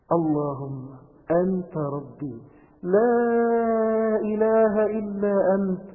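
slow, drawn-out prayer, rising in the middle; slow heartfelt recitation. Shown as a demonstration. Preacher reciting the prayer slowly for the youths. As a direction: pray it slowly, meaning each word. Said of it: "نقولها إزاي".

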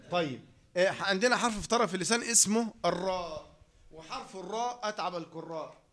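usual speaking voice

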